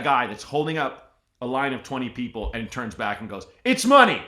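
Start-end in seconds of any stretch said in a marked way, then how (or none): 3.6-4.2 loud, high-pitched irritated customer; indignant mock shout; defensive outburst. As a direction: snap back loudly at the whole line. Acting out defensive customer holding up line.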